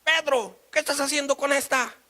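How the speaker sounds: high, loud accusing shout; outraged wife. Acted. Wife catching her husband leaving the hotel, outraged. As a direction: call out sharply, high and indignant.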